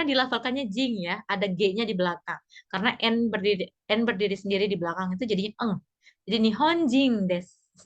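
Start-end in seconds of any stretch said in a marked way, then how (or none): 6.3-7.9 nihonjin with a soft, unpushed final jin. Shown as a demonstration. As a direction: keep the jin in nihonjin light, never hard. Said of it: "jingnya jangan ngegas".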